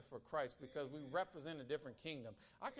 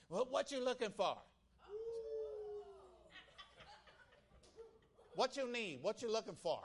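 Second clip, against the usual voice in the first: pushy rental lady; 8 semitones higher than usual. Retold or imitated. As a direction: mimic the lady hollering at the car. Preacher voices pushy rental lady.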